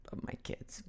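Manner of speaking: very soft, voiceless murmur; hushed aside. Real, not acted. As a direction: whisper it under your breath. Aside, then repeated as shout.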